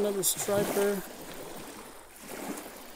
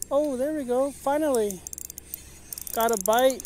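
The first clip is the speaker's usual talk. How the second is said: real excitement at a long-awaited bite, pitched up; higher, louder burst of relief as the fish finally bites; excited relief; 4 semitones higher, 3 dB louder than usual. Real, not acted. Long-awaited bite after an hour.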